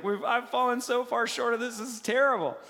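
high, loud imitated lament; mock crying. Israelites crying over their failure, imitated high loud lament. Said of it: "they're just crying because they're like".

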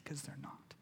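softly whispered reassurance. Real, not acted. Preacher telling listeners sin isn't their identity, whispered. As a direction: breathe it out as a whisper.

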